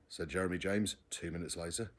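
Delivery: ordinary delivery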